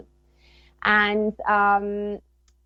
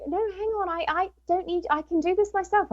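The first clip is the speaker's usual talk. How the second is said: high-pitched retold objection; hasty self-correction; 11 semitones higher than usual. Mother telling child she'll handle the spirit, high retold insistence. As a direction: jump in high, taking it back.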